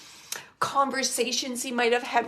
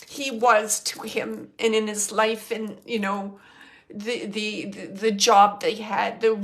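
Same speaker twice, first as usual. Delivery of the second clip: ordinary talk, nothing marked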